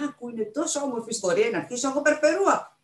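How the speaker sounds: can't wait to begin the story; eager. A narrator's reading. Child eager to recite the story.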